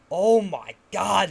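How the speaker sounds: overwhelmed with delight, stretched out slow; excited gasp. Real, not acted. To stream viewers about the Batman actor, slow awed excitement. Gushing about the movie's star.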